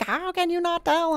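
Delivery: high-pitched mock-offended quote; playful, high mock indignation. Quoting offended people she mistook.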